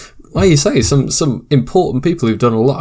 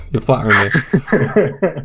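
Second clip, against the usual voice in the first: laughing; 4 semitones higher, slower than usual. Real, not acted. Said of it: "Oh, please, please don't".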